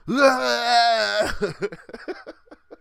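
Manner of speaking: high-pitched imitated shock wail breaking into laughter; mock electrocution yell. Storyteller acting out friend seizing up on the mic, mock shock yell. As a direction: wail like you're being zapped, then laugh.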